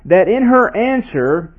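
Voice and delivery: ordinary talk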